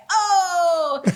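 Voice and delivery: high, loud, drawn-out oh; enthusiastic reaction. Retold or imitated. Host imitating the guest's reply to her invitation, high enthusiastic cry. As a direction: imitate someone bursting with enthusiasm. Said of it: "the enthusiasm".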